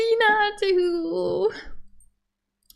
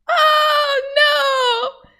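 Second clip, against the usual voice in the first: high despairing wail; 9 semitones higher than usual. Real, not acted. Trial ends without a kiss.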